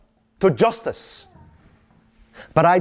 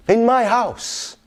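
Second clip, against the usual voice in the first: ordinary talk, nothing marked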